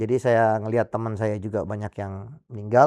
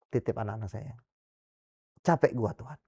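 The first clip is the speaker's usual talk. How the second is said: resigned prayer; 8 dB quieter than usual. Retold or imitated. Retold weary surrender prayer to God.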